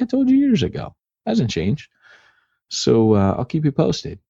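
high, mocking dopey husband voice; oblivious husband. Friend's husband brushing off his wife, high mock voice. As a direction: squeak it out like a clueless husband brushing her off. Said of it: "He's like".

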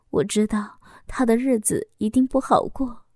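usual speaking voice

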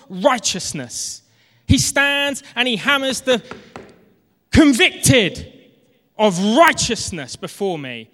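full-force preaching. Acted. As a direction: proclaim it to the whole church.

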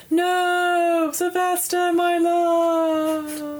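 high, loud anguished cry; grieving lover's wail. Acted. John crying out as Sylvester burns, high anguished wail. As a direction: wail over the lost love. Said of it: "you see John go".

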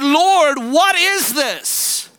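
high, drawn-out plea to God; retold desperate cry. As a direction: cry out upward in bewilderment, stretching the words.

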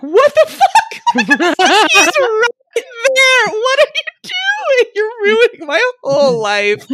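high, loud disbelieving squeal through laughter; laughing panic. Retold or imitated. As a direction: shriek in mock horror. Panicked inner reaction, laughing.

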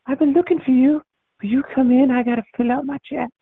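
put-on high, quavering old Southern lady voice; very high old lady voice, warm and inviting; old antebellum lady. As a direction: play a sweet old Southern grandma calling for her lawn boy. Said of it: "this actually sounds like an old antebellum lady".

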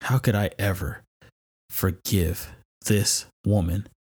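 slow, resentful grumble; how could I ever, said with a grudge; a touch of bitter disbelief at his wife. Retold or imitated. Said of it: "I'm like".

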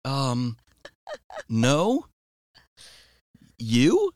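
hesitant, pitch up, with long pauses; retold sheepish reply. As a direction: act out an awkward denial, then turn it back. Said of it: "And I say".

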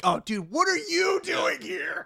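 high, drawn-out exasperated whine; Carl. Acted. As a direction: whine it slowly in a put-on high voice. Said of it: "it's like Carl".

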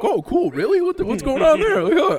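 thrilled to hear there's a fight brewing; excited surprise. Retold or imitated. Hearing of the fight at the gig, mock-thrilled high voice. Retelling his reaction to fight rumour.

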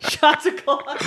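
laughing slowly through it; slow giggly call for shots. Real, not acted. Repeats shot call, laughing.

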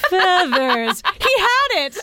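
triumphant discovery. Acted. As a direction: burst out with the answer, laughing. Dramatic reading, realization climax.

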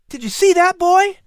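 put-on very high, loud boy's voice; excited exclamation. A narrator's reading. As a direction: exclaim it, bursting with delight. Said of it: "Patrick exclaimed".

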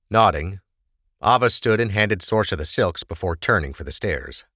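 ordinary delivery

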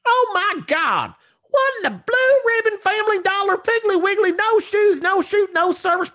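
very high falsetto Southern tirade; piercingly high, exasperated wife voice; shrieking country wife. Acted. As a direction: rant like an outraged country wife. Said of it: "A shriek rang through the house".

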